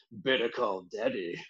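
low, slow, quiet sultry delivery. Shown as a demonstration. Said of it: "I love it".

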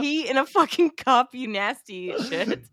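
telling Seth Rogen off for peeing; disgusted scolding. Retold or imitated.

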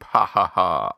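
mock evil cackle; hammy evil laugh; villain laugh. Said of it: "mustache-twirling".

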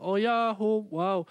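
mock her wowed reaction, drawn up high; girlfriend's reaction. Storyteller imitating girlfriend watching the rooster escape, mock wowed. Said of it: "meine Freundin war so".

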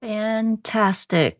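ordinary delivery

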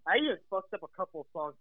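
ordinary talk

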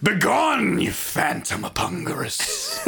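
slow, haunted ranting king; mad old king. Pretend. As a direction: cry out at a ghost.

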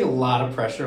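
ordinary delivery

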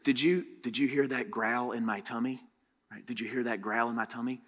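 soft, low secret into an ear; whisper. Acted. Pastor plays a kid whispering in the telephone game. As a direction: lean in and whisper the silly message. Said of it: "He's gonna whisper into Destiny's ear".

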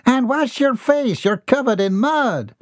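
high, firm call; mother nagging her muddy son; scolding mother. A narrator's reading. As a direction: order him to clean up.

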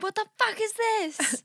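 slow, high mock outrage; bewildered, stretching it out; shocked reaction.

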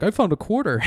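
popping up high and loud, goofy; goofy quote. Retold or imitated. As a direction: pop out of hiding with a silly line. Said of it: "He's like".

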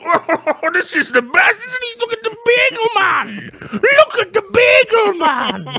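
very high, squealing gawker voice; mocking crowd. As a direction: hoot in exaggerated falsetto delight.

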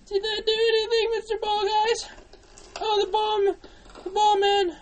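high, loud frantic wail; panicked squeal. Real, not acted. Player desperate to get out of the game, panicked. Panicking after being called bad.

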